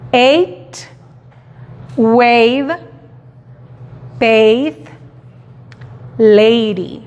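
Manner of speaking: "Eight" "wave" "bathe" "lady" con ei claro; repeat long A. Shown as a demonstration. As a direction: repite con a larga ei. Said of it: "El sonido largo de la letra A". (repetición de a larga)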